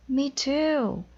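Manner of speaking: put-on high child voice; eager kids. Every student agreeing, high eager kid voice.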